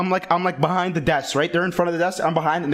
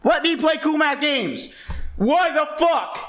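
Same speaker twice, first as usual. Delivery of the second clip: put-on pissed-off kid; 7 semitones higher than usual. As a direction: shout it like a furious ten-year-old. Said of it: "And I was pissed".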